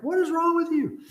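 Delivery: high-pitched scolding at a dog; acted-out fed-up pet owner; exasperated scolding. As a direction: cry out in mock exasperation. Exasperated at runaway dog, retold.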